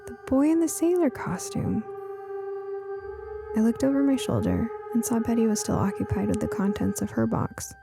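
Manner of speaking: ordinary delivery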